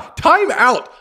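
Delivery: high, loud time-out; loud interruption. Real, not acted. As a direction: cut in loudly, high. Stops to object sharply.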